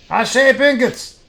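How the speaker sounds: loud, high-pitched foundry worker; shouting. A narrator's reading. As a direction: keep shouting over the noise of the works. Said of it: "He shouts".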